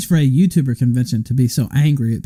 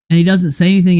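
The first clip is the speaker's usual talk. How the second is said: usual speaking voice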